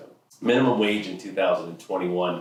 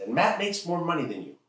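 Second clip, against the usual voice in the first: high-pitched father impression; challenging dad; 10 semitones higher than usual. Dad comparing teen's pay to friend's, pointedly. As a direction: press the point as a disbelieving dad.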